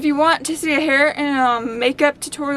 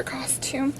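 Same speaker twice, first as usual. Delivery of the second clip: unvoiced whisper; 10 dB quieter than usual. Real, not acted. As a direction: breathe it in a whisper. Whispered aside while filming.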